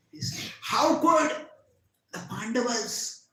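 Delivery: high, loud outraged cry; grief turned rage. A narrator's reading. Ashwatthama learns his father was killed, enraged. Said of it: "his grief turned into rage".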